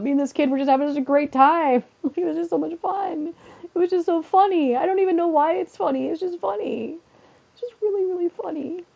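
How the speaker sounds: high, squeaky voice through laughter; helpless giggling. Real, not acted. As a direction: barely get it out for giggling. Giggling helplessly over classroom memory.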